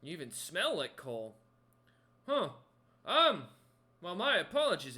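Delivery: puzzled, then loud and high apology; apologetic crewman. Acted. Crewman sizing up the fire giant, puzzled then apologetic. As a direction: realise the mistake and blurt an apology. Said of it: "looks you up and down ... and goes".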